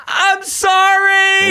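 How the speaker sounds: long, high screamed apology; screaming. Retold or imitated. Crashed driver apologizing mid-meltdown, screaming. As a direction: stretch it into a slow, piercing wail. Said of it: "I started screaming".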